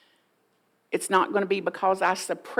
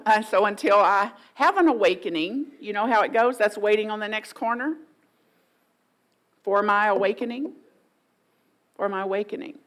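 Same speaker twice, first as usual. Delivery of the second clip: usual speaking voice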